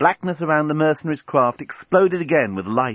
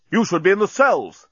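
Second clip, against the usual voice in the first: high, sharp captain's snap; snapping; 9 semitones higher, 4 dB louder than usual. A narrator's reading. Captain rebuking the escaped prisoners, snapping. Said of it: "snapped Bolfas".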